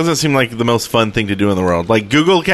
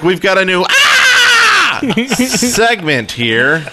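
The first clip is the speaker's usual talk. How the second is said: a sudden high mock yelp in the middle of the announcement; mock excited announcement; 8 semitones higher, much slower than usual. Teasing Spencer by imitating his announcing, a mock yelp. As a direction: ham up a cohost's outburst mid-sentence. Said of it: "Spencer's like".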